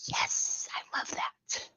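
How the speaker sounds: unvoiced whisper of approval. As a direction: whisper it, excited and secret. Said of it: "And I'm like".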